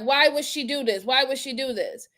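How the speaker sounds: ordinary talk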